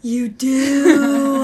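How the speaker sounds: loud and high, suddenly remembering; a sudden loud outburst on recalling the friend's phobia; surprised exclamation. Real, not acted.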